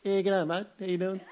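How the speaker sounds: laid-back Aussie g'day; laconic outback drawl. Shown as a demonstration. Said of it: "a low voice".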